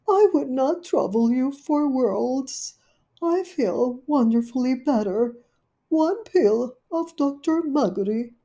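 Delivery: high, loud dismayed lady dog; fretting Duchess. Acted. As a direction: wring your paws over it. Duchess declines politely.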